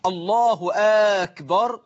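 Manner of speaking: أكبر opened with a long "aa", Aakbar; questioning hamza on أكبر. Shown as a demonstration. Teacher shows a worshipper's mistake, أكبر said as questioning "Aakbar". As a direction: stretch the first vowel of أكبر like a question. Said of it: "إدخال همزة الاستفهام على أكبر".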